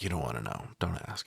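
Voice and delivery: low, hushed warning, voice dropped. Retold or imitated. Gail hushing the girls, low and quiet. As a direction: murmur it like a secret you won't share. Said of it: "she's like".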